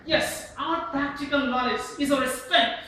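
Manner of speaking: loud, high-pitched statement of conviction; emphatic declaration. Shown as a demonstration. Teacher demonstrates emphatic speech to the class, emphatic and loud. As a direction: declare it forcefully, with strong feeling. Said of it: "very strong feelings".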